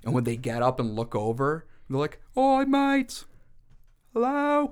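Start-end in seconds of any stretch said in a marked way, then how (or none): 2.4-4.7 very high, put-on soldier's hail; calling out. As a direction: call over in a squeaky-high comic voice. Soldiers calling out in German trench.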